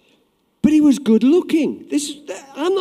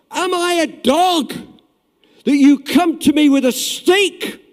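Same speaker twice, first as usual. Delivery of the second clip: louder, a touch of mock giant rage; angry giant; 5 semitones higher, 6 dB louder than usual. Preacher voicing the giant's insulted challenge to David, mock angry. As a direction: bellow it like an insulted giant, half-acted. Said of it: "the giant got angry".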